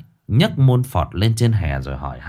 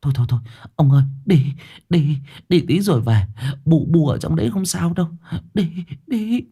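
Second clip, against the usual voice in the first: higher, hurried, stammered urging; flustered wife shooing her husband; urgent scolding wife; 8 semitones higher, faster than usual. A narrator's reading. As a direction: rush him away in nervous repeats. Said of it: "Bà quát".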